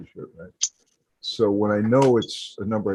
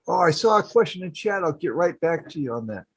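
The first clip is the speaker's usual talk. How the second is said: higher chatty streamer reply; mock streamer; 7 semitones higher than usual. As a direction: brush off the chat brightly. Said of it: "people start asking questions".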